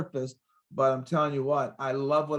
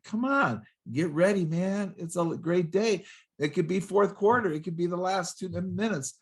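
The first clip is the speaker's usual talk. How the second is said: higher-pitched, urgent rallying cry; acted pep talk; 5 semitones higher than usual. Speaker rallying someone before the last minutes, pep talk. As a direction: rouse them as if the game is on.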